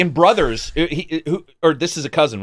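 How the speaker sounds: ordinary talk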